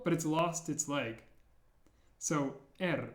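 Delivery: ordinary talk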